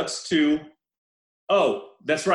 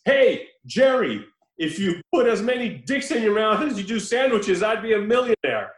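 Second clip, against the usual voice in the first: raised, higher calling-out voice; yelling across the street; 4 semitones higher than usual. Retold or imitated. Pimp calling to his worker across the street, yelling. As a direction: call out long on the name, mock yell. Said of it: "when he yells across the street".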